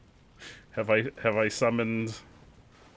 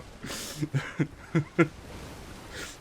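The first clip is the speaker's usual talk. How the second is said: breathless laugh; 4 semitones higher, 3 dB quieter than usual. Real, not acted. After escaping crab.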